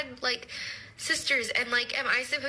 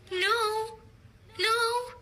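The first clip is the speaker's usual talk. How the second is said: mock-high no; 11 semitones higher than usual. Scoffing at her date's ideas about women, high squeaky no. Mocking cooking and cleaning stereotype.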